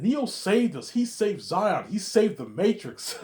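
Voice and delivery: high, loud pleading cry; high loud plea. Retold or imitated. Repeated higher.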